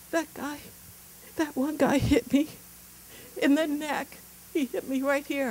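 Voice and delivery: high, teary kid voice imitated; crying little boy. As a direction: play the hurt son telling on the other player. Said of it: "I can see he's crying".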